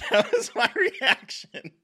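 breaking up with real laughter, high and loud; can barely get the words out for laughing; words squeezed out through helpless giggles; laughing hard. Real, not acted. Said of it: "Listener, she is dying".

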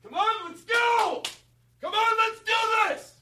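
very high, drawn-out shouts; sideline coach yelling. Acted. Coach firing up his team on the sidelines, shouting high. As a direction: pace and pump the players up. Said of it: "Can I try right now?".

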